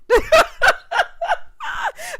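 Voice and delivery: very high cackling burst; real high-pitched laughing fit; big laugh. Real, not acted. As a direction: crack up helplessly. Laughs at her own recap.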